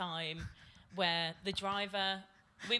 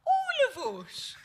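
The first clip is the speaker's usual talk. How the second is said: high, slow, loud Brummie drawl of shock; thick Brummie accent; 9 dB louder, much slower than usual. Retold or imitated. Bobby Beale answering the furious driver, slow high Brummie. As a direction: stretch it out in broad Brummie. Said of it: "In the most, in the most brummiest accent".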